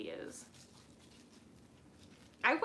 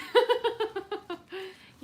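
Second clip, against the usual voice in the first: delighted laugh; 9 semitones higher, 9 dB louder than usual. Real, not acted. Reacting to a viewer's comment.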